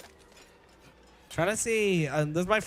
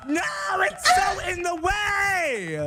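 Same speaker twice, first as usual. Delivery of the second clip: frustrated shout; 11 semitones higher than usual. Real, not acted.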